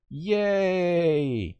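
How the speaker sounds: high, drawn-out "yay"; mock cheer. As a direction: cheer with fake enthusiasm. Sarcastic cheer at gameplay change.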